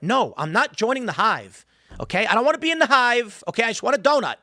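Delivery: high, mock-indignant protest; exasperated refusal. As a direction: refuse in comic exasperation, pitch climbing. Acted refusal to join the hive.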